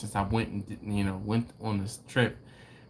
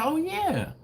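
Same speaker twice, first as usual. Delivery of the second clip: much higher-pitched waitress voice; imitating waitress. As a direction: mimic the waitress up high. Retold waitress's reply in dialogue.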